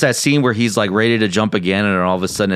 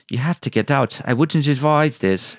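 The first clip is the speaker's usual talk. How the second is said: put-on little girl AI voice; 5 semitones higher than usual. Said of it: "Love her voice".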